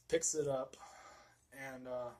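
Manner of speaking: ordinary talk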